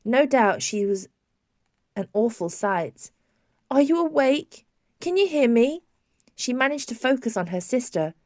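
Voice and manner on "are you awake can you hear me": anxious calling. A narrator's reading. Sister anxiously calling patient.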